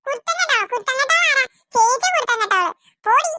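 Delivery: put-on high childish retort; indignant little girl. Little sister snapping back at her elder sister. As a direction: throw the insult back.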